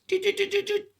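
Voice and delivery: high beeping doot doot; thermometer beeps. Retold or imitated. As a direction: beep like a thermometer. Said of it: "and it goes".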